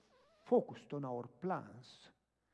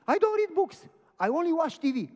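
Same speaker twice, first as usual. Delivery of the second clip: high, loud protesting woman; loud refusal; 15 dB louder than usual. Retold or imitated. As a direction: object shrilly as the reluctant lady. Woman refusing the book, retold.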